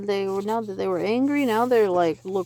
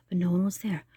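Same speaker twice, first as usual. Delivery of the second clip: plain reading voice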